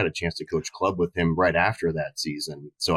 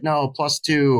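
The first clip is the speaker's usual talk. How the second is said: higher-pitched yelled correction; mock yell; 8 semitones higher, 3 dB louder, slower than usual. Player correcting the coach's scorekeeping, mock yell. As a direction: call it out high, in a half-hearted yell. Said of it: "would yell over at me".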